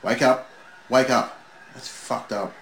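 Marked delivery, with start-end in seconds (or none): 0.0-1.4 louder, higher call to rouse someone; mock urgent wake-up call. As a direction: call at the screen to wake him. Calling to the wrestler on screen.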